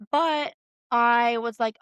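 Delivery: usual speaking voice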